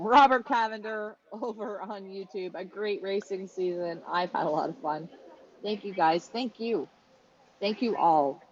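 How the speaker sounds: ordinary delivery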